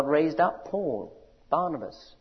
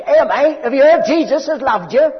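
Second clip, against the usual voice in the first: loud, high, matey call with a laugh; common street preacher; 11 semitones higher, 15 dB louder than usual. Retold or imitated. Lollard greeting a passer-by, hammed-up matey shout. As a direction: hail a stranger like a cheery commoner. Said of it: "They didn't speak in Oxbridge accents".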